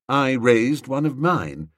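plain reading voice

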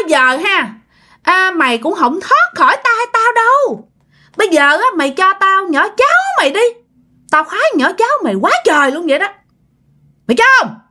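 put-on high, sinister old-woman voice; high-pitched, mostly quick crone; threatening ghost hag. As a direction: press a menacing demand as a ghost.

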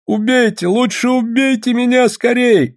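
high-pitched desperate plea; terrified cry. A narrator's reading. Criminal facing frightful faces, terrified high cry. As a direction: cry out high, begging to be finished off. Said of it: "закричал преступник, видя страшные лица".

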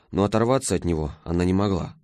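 ordinary delivery

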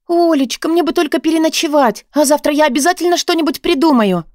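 high, wheedling plea; pleading. A narrator's reading. Homeless woman asks friend for a night's stay, pleading.

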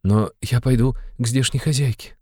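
high, pleading mother's voice; insistent. A narrator's reading. Mother wants the landlady's help, insistent. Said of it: "настаивала Пульхерия Александровна".

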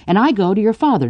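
usual speaking voice

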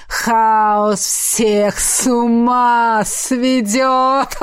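very slow taunting chant; зловещий напев. A narrator's reading. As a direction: draw out each rhyme like a mad spirit. Хаос дразнит нараспев перед хохотом. (зловещий тягучий распев)

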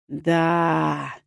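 low, rasping troll voice; deep hoarse troll. A narrator's reading. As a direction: drawl it from deep in the chest like a troll. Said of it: "глубоким хрипловатым голосом". (низкий хриплый голос тролля)

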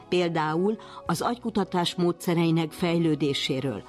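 ordinary talk

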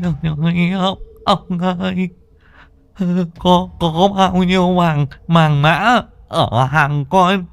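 high, garbled, tongue-tied pleading in fright; terrified stammering. A narrator's reading. Lame vendor offering ghost all his goods, high terrified stammer. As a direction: stutter in high-pitched terror, slurring the words. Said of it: "cái lưỡi ngắn ngôn ngổn cứ lắc la lắc lư lắp bắp".

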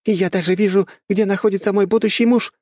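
high, loud, eager female voice; predatory exclamation. A narrator's reading. Young woman spotting her future husband in battle, eager exclamation. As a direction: call it out with predatory glee. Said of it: "воскликнула и хищно оскалилась".

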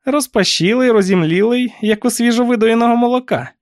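high, pointed aunt character voice; accusing aunt. A narrator's reading. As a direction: pick at the niece in a high voice. Aunt describes niece's flushed look. (високий прискіпливий голос тітки)

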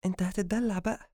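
high, soft playful chiding; amused teasing. Acted. Habiba teases Adham for wanting pampering, amused. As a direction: tease him lightly, amused. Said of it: "ضحكت حبيبة وقالت".

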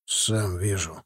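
low, quiet gruff retort; snapping back. A narrator's reading. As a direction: snap back under the breath. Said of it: "огрызнулся Тимофей".

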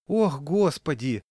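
slow, loud grandmotherly lament; sighing granny. A narrator's reading. Granny dismayed by the dog, sighing. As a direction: sigh it out long and high. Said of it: "вздохнула какая-то бабушка".

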